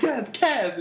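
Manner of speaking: high-pitched mock insistence; retold worked-up insistence. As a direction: imitate someone excitedly standing by his pick. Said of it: "getting all ex".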